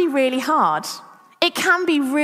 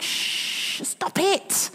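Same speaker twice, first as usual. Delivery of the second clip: hushing rebuke; 5 semitones higher, 6 dB quieter, much slower than usual. Retold or imitated. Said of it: "people start rebuking him".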